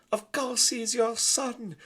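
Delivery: put-on very high, loud French-accented mother; haughty French lady; French mother mouse. Antoinette answering her husband, high French haughty.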